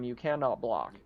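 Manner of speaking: ordinary delivery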